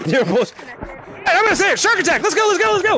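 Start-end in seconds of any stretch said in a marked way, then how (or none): 1.3-3.0 high-pitched rallying cry; rally the players into the chase; excited game call. Real, not acted. Starting a round of tag.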